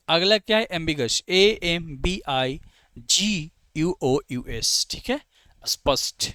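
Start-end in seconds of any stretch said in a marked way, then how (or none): 1.3-4.9 very slow letter-by-letter spelling. Shown as a demonstration. Teacher spelling ambiguous for the class, slowly letter by letter.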